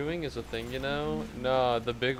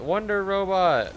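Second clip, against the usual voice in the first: louder, slow, higher booming announcement; mock announcer; 7 semitones higher, 4 dB louder, slower than usual. Streamer repeating the title to chat, booming and slow. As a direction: proclaim it grandly each time.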